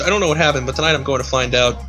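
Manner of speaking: ordinary delivery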